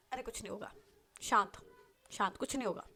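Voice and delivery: soothing a friend softly during a hug. Acted. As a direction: hush her gently as you hold her. Sehar hugs and soothes her friend.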